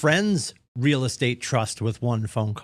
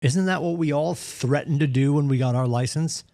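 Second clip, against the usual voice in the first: ordinary talk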